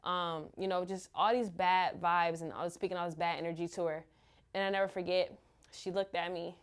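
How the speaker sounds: ordinary talk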